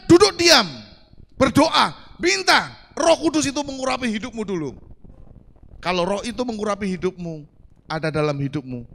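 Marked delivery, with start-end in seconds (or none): 0.1-4.7 loud, high proclamation of the verse; proclaimed scripture. Acted. Preacher to congregation, proclaiming the command loudly. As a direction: ring it out like a command from the pulpit. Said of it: "Firman Tuhan katakan".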